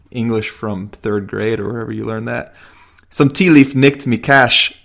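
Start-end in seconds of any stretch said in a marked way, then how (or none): 3.1-4.7 louder, slow, wobbly Irish-ish attempt; a little Irish accent. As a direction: badly fake an Irish lilt, slowly. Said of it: "That was like a little Irish".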